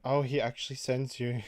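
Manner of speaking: normal talking voice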